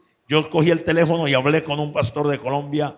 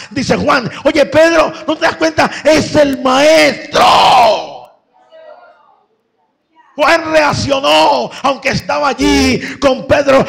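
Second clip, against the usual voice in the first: high, shouted climax with stretched exclamations; loud, thrilled pulpit shouting; full-force preaching; 8 semitones higher, 6 dB louder than usual. Acted. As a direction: cry out the sudden recognition like a preacher.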